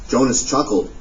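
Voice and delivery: ordinary delivery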